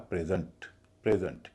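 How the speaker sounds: Present with the first syllable stressed, said PRE-zent; PRE-zent, first syllable strong. Shown as a demonstration. Teacher demonstrates the noun form, first syllable stressed. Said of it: "क्योंकि पहले हिस्से पर stress है".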